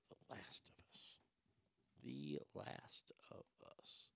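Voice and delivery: slow, barely audible whisper; puzzled whisper under the breath; whispering to self. Real, not acted. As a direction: whisper it slowly while thinking it over. Puzzling over title to self.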